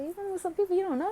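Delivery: mom questioning. Retold or imitated. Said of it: "She's like".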